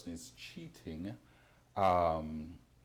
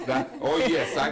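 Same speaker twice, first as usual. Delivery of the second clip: much louder, laughing through the words; 5 semitones higher, 11 dB louder than usual. Real, not acted. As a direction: laugh while trying to agree. Host laughing, recognizing the situation.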